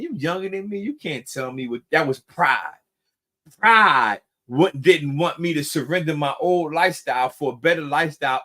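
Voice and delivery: ordinary talk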